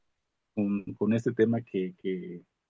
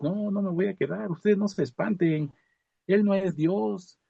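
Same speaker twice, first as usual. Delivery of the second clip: higher, emphatic refusal; firm refusal; 9 semitones higher than usual. Retold or imitated. Old man refusing to stay in hospital, higher emphatic refusal. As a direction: insist you are not staying.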